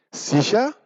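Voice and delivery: high echoing "Sicher?" with a rise; incredulous beaver. Acted. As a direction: throw the word back in disbelief. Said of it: "wiederholte der Biber". (Biber, ungläubig hoch nachfragend)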